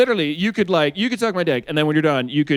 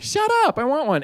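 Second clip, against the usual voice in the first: put-on eager kid; 8 semitones higher than usual. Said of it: "I was like".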